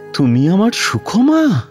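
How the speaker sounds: high-pitched, incredulous question; overwhelmed recognition. Acted. Doctor finds his long-lost Sukhoma, overwhelmed. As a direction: ask it in dawning, emotional disbelief.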